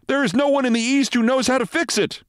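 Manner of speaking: very high, alarmed cry; shouting intern. A narrator's reading. Intern despairs over the broken machine, high shouting. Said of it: "shouted an intern".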